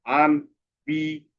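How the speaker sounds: "আনবিলিভএবল" slow, a gap after every syllable; split into syllables. Shown as a demonstration. Teacher showing how small the parts get, "আনবিলিভএবল" split slowly. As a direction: chop "আনবিলিভএবল" into five separate small pieces. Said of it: "এই শব্দগুলো ভাগ করলে".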